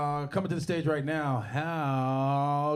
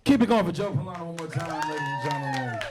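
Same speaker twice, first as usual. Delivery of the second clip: fast, loud, higher crowd-pumping shout; emcee hype call; 5 semitones higher, 3 dB louder, faster than usual. Acted. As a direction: pump the crowd like a club host. Host hyping crowd.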